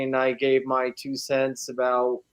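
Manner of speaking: ordinary talk, nothing marked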